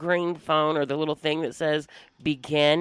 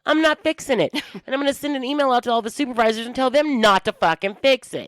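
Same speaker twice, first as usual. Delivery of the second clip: higher, louder, fast rant; heated retelling; 7 semitones higher, 4 dB louder than usual. Retold or imitated. Supervisor telling off an agent drinking coffee, heated. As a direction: build it into an angry tirade.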